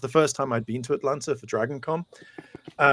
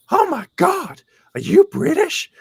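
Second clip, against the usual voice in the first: very high, loud, starstruck squeal; excited American fan; 8 dB louder than usual. Retold or imitated. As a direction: gush in a loud high-pitched put-on voice. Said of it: "I'll do voices as well".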